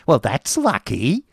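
lightly voiced grudging wife, slow; a hint of a cold mistress; Mrs Squeers' voice. A narrator's reading. Wife answering her husband, slow and grudging. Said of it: "said Missus Squeers".

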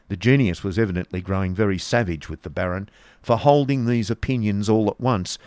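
plain reading voice

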